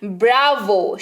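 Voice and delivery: ordinary delivery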